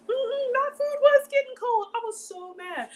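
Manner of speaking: high, loud mock-indignant mm-hmm; high put-upon whine; indignant complaint. Real, not acted. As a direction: play up the grievance. Complaining about cold food.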